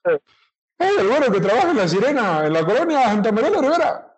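fast, high costeño accent from Guerrero. Shown as a demonstration. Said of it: "pero lo voy a intentar".

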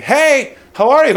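high, bright-pitched hello; over-cheery phone greeting. Retold or imitated. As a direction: greet them way up high, acting breezy. Estranged friend's phone greeting acted out.

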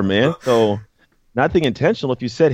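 ordinary talk

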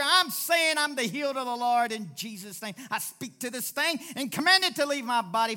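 loud, forceful declaration of healing; full-force faith proclamation, rising and loud; preaching declaration. Acted. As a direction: proclaim it like a command from a sickbed.

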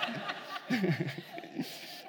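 unvoiced wheezy laugh; quiet airy chuckle; breathy silent laugh. Real, not acted. Laughing at own paraphrase.

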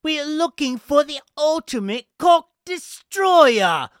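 very high, loud, slow British accent impression. Quoting Sophie from a British show, hammed-up British accent. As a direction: ham up a posh British reality-show accent. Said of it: "I'm obsessed with that accent".

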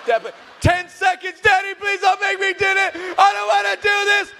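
loud, high pleading wail; panicked daughter. Retold or imitated. Daughter begging her dad not to make her, high panicked wail. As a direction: beg in a high mock panic.

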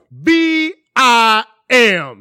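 high, loud, very slow drawn-out letters; commercial jingle shout. Retold or imitated. Store commercial on Hot ninety-seven, loud drawn-out imitation. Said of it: "would be like".

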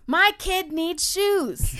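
high, loud, drawn-out wail; mock desperate plea. Parent pleading for the family's income, mock desperate wail. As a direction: cry it out slowly, hammed-up. Acted plea over lost livelihood.

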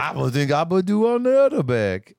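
high, put-on mocking voice of Eric; mock him in a high silly voice; Eric impression. Mocking Eric's voice to her partner, high and silly. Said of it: "that's how he sounds".

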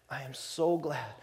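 hushed, unvoiced I am so glad; soft whisper of relief; whispered joy. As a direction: whisper it close, overcome. Father's joy at son's return.

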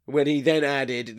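normal talking voice

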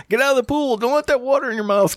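high, shooing bigot voice. Retold or imitated. Joking about pool racism, high mock bigot. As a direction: shoo them off like a cartoon bigot. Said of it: "kind of thing".

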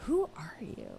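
breathy whisper, no voice at all; whispered echo. Real, not acted. Whispering back to listeners asking who they are.

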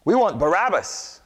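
high, loud shout of a mob; crowd shouting. Acted. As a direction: yell it back at Pilate. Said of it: "they shouted back".

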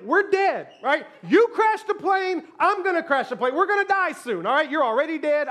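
high, loud, hammed-up doom; comic panic. Novice flyer berates the calm pilot, panicking.

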